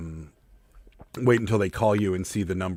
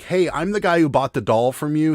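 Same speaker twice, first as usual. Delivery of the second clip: higher, louder retold complaint; raised-voice mimicry of an upset customer; freaking-out caller; 7 semitones higher, 6 dB louder than usual. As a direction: imitate an agitated buyer on the phone. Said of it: "And he's freaking out".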